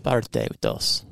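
Spanish accent with a tapped r in "Parte"; Spanish-accented "Parte dos". Shown as a demonstration. Contrasted with the American version.

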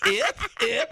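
repeated high mock-French yips; French accent. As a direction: yip like a bumped French tourist. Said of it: "that's the Frenchman in Wisconsin".

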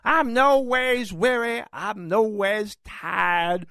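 high, slow put-on preacher accent; mock Hillary Clinton southern drawl. Host mocking Hillary Clinton's speech, slow put-on accent. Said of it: "sounding like Amos and Andy".

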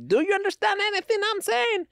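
high-pitched movie character impression; high, put-on film voice; movie line impression. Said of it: "when he starts talking to him".

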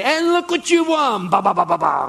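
high, loud prize reveal with sung-out fanfare; over-the-top game show hype; game show announcer. Acted. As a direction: announce the big win like a TV host. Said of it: "have you ever watched a game show".

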